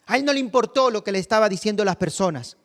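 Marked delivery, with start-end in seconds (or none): 0.0-0.2 high, insistent plea; insistent crying out. Acted. Blind man persisting after being rebuked, crying out. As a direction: cry out high despite being hushed. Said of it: "él clamaba mucho más".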